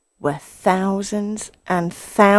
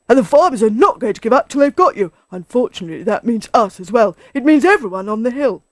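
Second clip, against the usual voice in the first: loud, fast, indignant tirade; angry badger, words rushing out; furious; 4 semitones higher, 8 dB louder, faster than usual. A narrator's reading. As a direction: scold him for endangering everyone. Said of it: "said Badger furiously".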